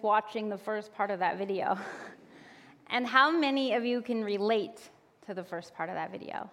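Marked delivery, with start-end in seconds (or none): none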